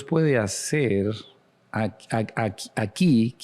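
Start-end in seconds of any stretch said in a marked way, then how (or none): none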